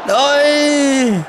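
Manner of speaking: excited shouts. Real, not acted. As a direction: cheer on the attack in excitement. Near miss chance in game.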